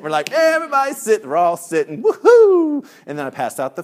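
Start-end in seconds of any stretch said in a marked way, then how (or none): 0.3-1.2 high, loud mock call; group shout. 2.0-2.9 high, loud whoop; mock cheer. Cheer once all are sitting.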